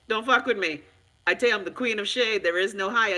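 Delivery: ordinary talk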